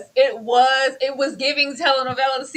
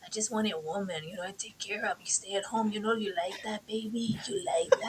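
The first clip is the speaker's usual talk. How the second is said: soft, low and creepy crooning; creepy suitor imitation; 4 semitones lower, 14 dB quieter than usual. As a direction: lean in like a sleazy charmer.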